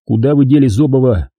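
usual speaking voice

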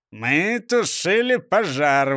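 slow, pompous explanation; puffed-up official tone; self-important. A narrator's reading. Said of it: "важно объяснил браншеф".

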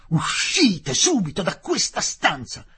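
slow, loud, high order to get out; furious shout. Acted. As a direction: shout him out of the room. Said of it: "Gridò".

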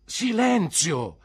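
high, loud, drawn-out shout; exasperated teacher's shout; shouted command. Acted. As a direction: cry out for order over a rowdy class. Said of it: "gridò mister Mell alzandosi improvvisamente".

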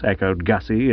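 plain reading voice

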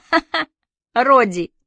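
high, spoken ha-ha; obliging fake laugh; mock laugh. A narrator's reading. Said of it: "в этом месте положено смеяться".

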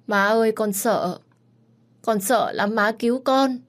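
high, loud whimpering pleas; a frightened boy calling for his mother; scared crying child. A narrator's reading. Locked-in boy crying to his mother in the dark. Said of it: "vừa khóc".

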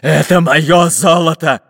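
loud, slow, greedy grumble; grumbling pirate. A narrator's reading. Captain hugging the chest, grumbling. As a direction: growl possessively over the gold. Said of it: "пробурчал капитан Буль-Буль".

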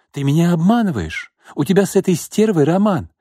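jealous fiancée accusing him of an affair, angry; jealous accusation. A narrator's reading. Jealous woman accusing her fiancé.

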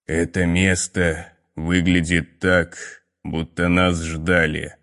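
low, slow hoarse half-whisper; hoarse whispery voice. Acted. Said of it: "хриплый, словно бы шепчущий голос".

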